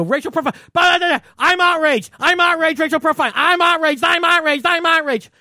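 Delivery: high, loud, whiny parrot of repeated outrage; mock the knee-jerk internet crowd, shrill and on repeat; mock outraged internet people. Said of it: "people just in their heads go".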